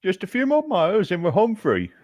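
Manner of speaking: a hint of a hopeful guide leading the way home; Damien speaking. S1 voices Damien riding ahead.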